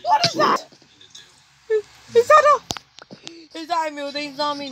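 very high, loud frightened shriek; panicked, voice cracking up high; scared horror game streamer. Real, not acted. Terrified on seeing a mutant zombie.

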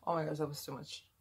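ordinary talk, nothing marked

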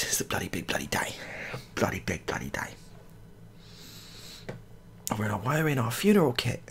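ordinary talk, nothing marked

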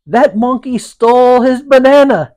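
British lady's outcry, high and loud; loud, high-pitched mock-British indignation; bad British accent. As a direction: exclaim in a hammed-up British accent. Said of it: "in my best British accent".

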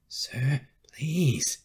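high, whispery plea to a superior; breath-filled, rising appeal; pleading. Acted. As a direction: beg urgently on a breathy voice. Carrie begs officer not to withdraw.

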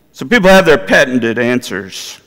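ordinary talk

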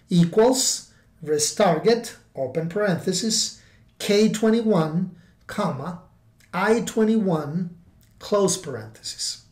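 dictating. Shown as a demonstration. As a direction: spell out each argument. Reading out a formula.

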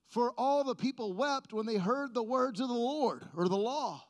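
usual speaking voice

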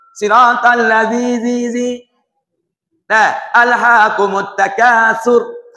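"Siratal ladzi zi zi" slow with a hard zi; slow drill on the z sound; slow recitation. Shown as a demonstration. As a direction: recite slowly, the zi stressed.

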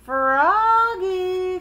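high, loud singsong call; mother calling out. A narrator's reading. Mother calls her son Froggy, singsong calling out. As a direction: call the child from afar, stretching the name. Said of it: "called his mother".

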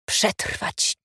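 unvoiced, grudging mutter; grumbled reply. A narrator's reading. Ada answering her friend's question, grumbled. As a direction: grumble the one word back. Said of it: "odburknęła Ada".

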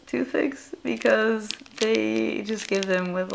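ordinary talk, nothing marked

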